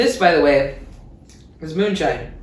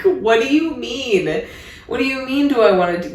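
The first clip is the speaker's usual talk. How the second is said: mock baffled; 7 semitones higher than usual. To the guy asking if she's sure, baffled. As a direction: drag it out like it makes no sense. Baffled reply to his doubt.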